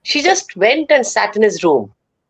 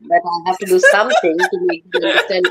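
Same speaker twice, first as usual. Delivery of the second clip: cat's voice. Retold or imitated. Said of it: "It must have been like this".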